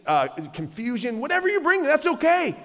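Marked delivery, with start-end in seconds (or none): 1.2-2.5 high-pitched, reassuring call; high, open-hearted encouragement; encouraging welcome. Acted. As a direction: wave them in with a lifted voice. Preacher reassuring the congregation in quote.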